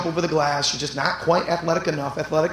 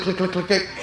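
usual speaking voice

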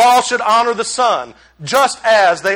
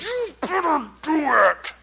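mock angry; 6 semitones higher, 6 dB quieter, slower than usual. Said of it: "I almost got angry right then".

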